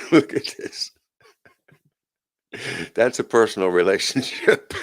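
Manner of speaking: cracking up at what you're showing; amused, words breaking into laughs; laughing. Real, not acted. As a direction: talk through real laughter. Cracks up at a picture.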